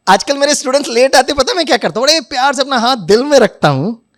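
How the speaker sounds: ordinary delivery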